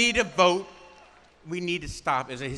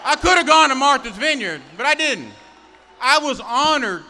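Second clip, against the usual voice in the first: roaring rallying speech; 8 semitones higher, 12 dB louder than usual. Acted. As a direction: bellow it at the crowd. Rally speaker shouting at crowd.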